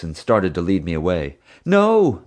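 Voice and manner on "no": high, loud cry of protest; anguished cry. Acted. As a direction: cry out in desperation. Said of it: "Mom cried".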